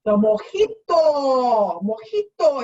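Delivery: loud, high, long drawn-out call; triumphant announcement. Pretend. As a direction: belt out the big reveal, stretching the vowels. Announces the promised drink.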